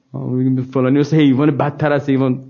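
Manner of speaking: usual speaking voice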